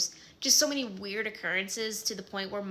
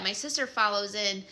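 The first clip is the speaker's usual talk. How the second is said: ordinary delivery, nothing marked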